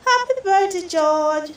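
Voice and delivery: high, loud birthday cheer; loud happy birthday cheer; group shout. A narrator's reading. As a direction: shout the greeting together. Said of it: "shouts everyone".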